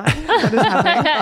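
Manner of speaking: laughing. Real, not acted. Laughing at herself.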